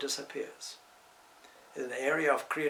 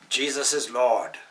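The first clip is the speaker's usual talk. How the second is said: loud, firm retold proclamation; adamant declaration; 10 dB louder than usual. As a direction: boom it out like a stranger passing in the street. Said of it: "and adamantly said".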